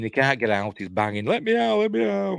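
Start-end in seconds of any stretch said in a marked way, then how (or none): 1.2-2.4 higher, panicked plea, mock scream; half-hearted mock panic, pitched way up; retold screaming. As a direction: imitate a trapped boy begging to be let out. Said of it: "He's screaming".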